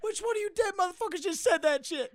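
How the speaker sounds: high-pitched put-on outraged voice; mock arguing. Heckler talking shit back across the theater, mock outrage. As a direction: push it way up, hammed-up indignation. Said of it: "they just start talking shit".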